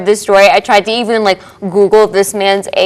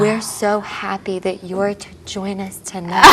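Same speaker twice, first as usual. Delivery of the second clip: low ASMR voice; 12 dB quieter than usual. Real, not acted. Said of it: "like, the low A S M R voices".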